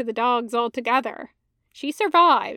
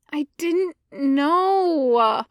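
slow, drawn-out plaintive words; 4 semitones higher, much slower than usual. Acted. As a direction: plead it sadly and slowly. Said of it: "he says plaintively".

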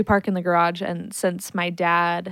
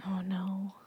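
sympathetic whisper; 11 dB quieter than usual. Real, not acted. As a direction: breathe it out in a whisper. Reacting to screaming baby.

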